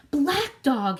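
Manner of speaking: gasped. A narrator's reading. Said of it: "he gasped".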